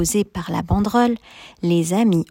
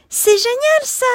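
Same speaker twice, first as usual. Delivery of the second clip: very high, louder delighted cry; excited exclaim; 4 dB louder than usual. A narrator's reading. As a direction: burst out with delight. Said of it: "s'écrie Abby".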